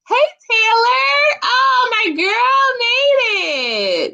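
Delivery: high, drawn-out squeal of welcome; excited greeting. Real, not acted. Host welcoming friends into the live chat, squealing with excitement. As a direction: squeal it out, stretching the words.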